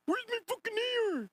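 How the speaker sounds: high squealing imitation of the clip guy; mocking high squeak. Streamer mocking the viral clip, high squeal. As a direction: mimic his high whiny yell.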